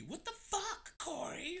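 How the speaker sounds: high mock-indignant woman's voice; mimicking his partner. Dad imitates son's mother blaming him, high mocking tone. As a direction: squeal it like she's scolding him. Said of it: "And she always blame me".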